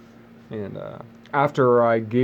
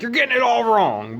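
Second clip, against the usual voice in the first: mock know-it-all; 11 semitones higher than usual. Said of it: "you're gonna be like".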